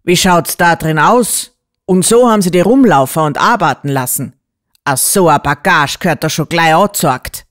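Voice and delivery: loud, outraged country doctor; indignant cry. A narrator's reading. Doctor appalled at how the girl was treated, loudly indignant. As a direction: let the outrage ring out. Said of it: "schrie er da".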